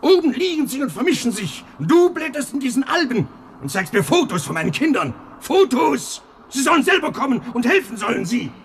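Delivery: put-on railing at the absent children; agitated lament. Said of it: "Du bist außer dir".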